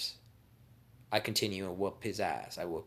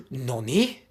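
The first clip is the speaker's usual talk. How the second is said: loud, raised anime-style disbelief; shocked Kakashi; 4 semitones higher, 10 dB louder than usual. Acted. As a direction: blurt it in hammed-up shock. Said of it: "And Kakashi's like".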